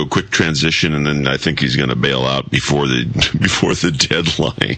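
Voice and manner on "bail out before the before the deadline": distorted, deep Darth Vader line. Real, not acted. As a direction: sounds like Darth Vader over a bad line. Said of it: "you're too Darth Vadery".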